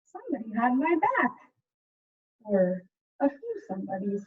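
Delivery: put-on lively picture-book narration for kids; story reading. A narrator's reading. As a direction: read it like a storyteller to a class. Teacher reads picture book aloud.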